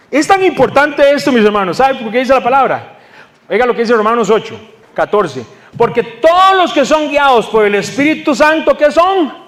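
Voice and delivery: high, emphatic sermon voice; impassioned preaching. Acted.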